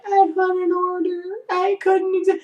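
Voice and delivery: high, soft, whimpered defence; meek excuse. Retold or imitated. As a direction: shrink back and plead, acting it out. His whimpered excuse after her yelling.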